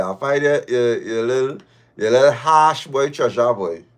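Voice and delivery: ordinary delivery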